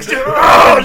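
a high, loud raging "Argh!"; angry gamer roar. Retold or imitated. Gamer recalls raging at the last boss, shouting. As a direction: let the frustration burst out as a roar. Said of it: "making these noises".

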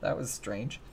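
softly acted mundane surprise; understated reaction. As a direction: drop the volume, barely fazed. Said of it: "It was just kinda like".

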